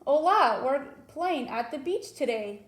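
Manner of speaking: teacher reading an exclamation to students, excited. Shown as a demonstration. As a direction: read it like a thrilled kid at the beach. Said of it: "you use a different type of tone".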